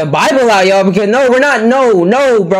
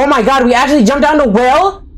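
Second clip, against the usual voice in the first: shocked disbelief; 9 semitones higher than usual. Real, not acted. Shocked by jumping down well.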